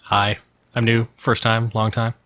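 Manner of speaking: ordinary delivery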